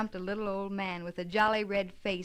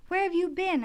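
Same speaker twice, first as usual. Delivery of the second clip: put-on excited child; 9 semitones higher, 7 dB louder than usual. Little Davy's excited question.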